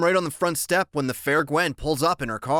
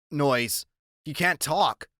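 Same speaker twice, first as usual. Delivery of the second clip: ordinary delivery, nothing marked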